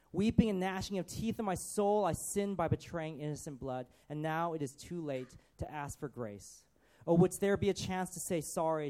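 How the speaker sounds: loud, dramatic lament of a guilt-ridden traitor; anguished Judas soliloquy. Acted. Preacher reading Judas's soliloquy to the congregation, anguished lament. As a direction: cry out his remorse, rising and falling. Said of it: "let me read the first one out, Judas's soliloquy".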